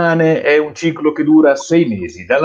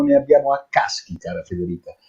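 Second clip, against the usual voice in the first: ordinary talk, nothing marked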